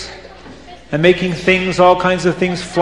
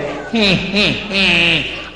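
wicked cackle of a plotting witch; 4 dB quieter than usual. Acted. Witches plotting trouble, cackling wickedly. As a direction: cackle with glee.

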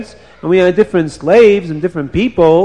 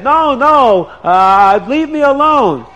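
high, hammed-up cry of no; mock protest; 7 semitones higher, slower than usual. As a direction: fake helpless pleading. Wife acts out refusing.

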